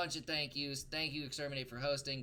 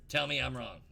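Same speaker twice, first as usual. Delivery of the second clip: ordinary talk, nothing marked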